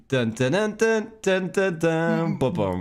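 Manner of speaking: sung game-over jingle; death sound jingle. Pretend. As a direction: hum a little dying tune. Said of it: "That's my death sound".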